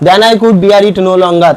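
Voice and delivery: slow, loud English read for the class; slow classroom reading. Shown as a demonstration. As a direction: read each word out slowly and clearly. Teacher reads English text aloud.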